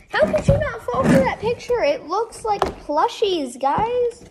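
high and loud exasperated whine; indignant complaint. Real, not acted. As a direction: complain loudly, high-pitched. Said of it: "I'm mad".

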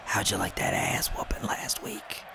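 sneaky hushed whisper; whispering a sly taunt at a rival. Pretend.

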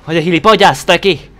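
loud, annoyed shout at an enemy; angry shout. Real, not acted. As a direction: snap at him loudly. Yelling at the game enemy.

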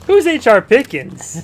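loud, drawn-out confused question; baffled shout. Real, not acted. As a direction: shout it in disbelief.

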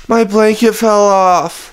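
put-on high, slow child's complaint; little sister whining. Little sister telling mom her trouble, high slow whine. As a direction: draw it out like a small child. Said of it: "she's like".

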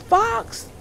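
put-on mother calling her child from afar; high, loud, drawn-out call. A narrator's reading. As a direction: sing it out across the yard. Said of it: "called his mother".

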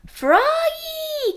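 high, loud drawn-out call of Froggy!. A narrator's reading. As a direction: call him from far away, stretched long. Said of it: "called his father".

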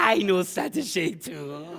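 soft, slow, teasing tone; soft slow cheeky coo; teasing tender. Shown as a demonstration. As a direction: coo at the manager playfully. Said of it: "میگی".

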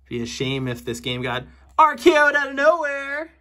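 high, loud, hammed-up menace; mock wrestling threat. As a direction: tease it like a cartoon villain. Mock threat about RKO.

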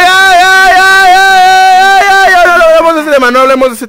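very high, very loud real outburst; shouting over someone. Real, not acted. Streamer shuts down a viewer's inappropriate message, yelling. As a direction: drown it out with a long screamed "ya".

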